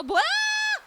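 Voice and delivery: sudden very high loud cry; startled yelp. Real, not acted. Startled mid-word by the game, squealing. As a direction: jump with a squeal.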